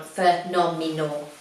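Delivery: PHENOMENAL sounded out slowly, syllable by syllable; careful pronunciation. Real, not acted. Vlogger praising trousers has to say it like that, PHENOMENAL carefully broken into slow syllables. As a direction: take "phenomenal" slowly, one piece at a time. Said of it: "I have to say it like that".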